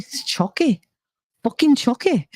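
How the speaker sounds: put-on high-pitched cursing toddler; imitated little girl. Retelling a favorite clip of a cursing kid, imitated child voice.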